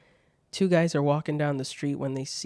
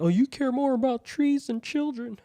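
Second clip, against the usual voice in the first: high, nasal-free mock whine of an online critic, much higher; 9 semitones higher, 3 dB louder than usual. Streamer mimicking angry commenters, mock scolding. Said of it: "They're like".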